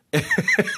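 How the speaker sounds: high squealing laugh; high burst of laughter. Real, not acted. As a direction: let out a sudden high laugh. Laughing at the story.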